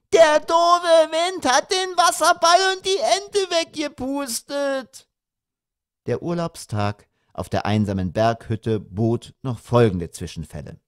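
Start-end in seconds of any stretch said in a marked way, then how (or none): 0.1-5.1 put-on very high screeching little-boy wail; bawling son. A narrator's reading. Son howling over his lost beach toys, bawling. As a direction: bawl it out at the top of a child's voice. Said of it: "brüllte er wie am Spieß".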